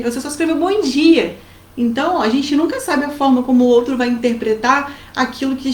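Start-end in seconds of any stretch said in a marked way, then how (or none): 0.6-1.4 higher, lively greeting; upbeat, friendly good morning; cheerful good morning. Acted. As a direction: say it as if with an exclamation mark. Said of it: "nem foi isso que você quis dizer".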